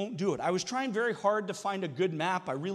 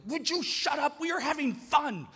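higher, exasperated parent snapping; mock snap at the kids; 8 semitones higher than usual. Dad snapping at complaining boys on a hike, mock exasperated. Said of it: "snap, and be like".

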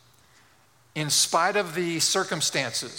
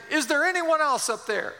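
high-pitched call up to the sky; panicky mock shout; 10 semitones higher than usual. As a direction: play it panicky, calling for anyone else. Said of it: "still panicky, shouts out again".

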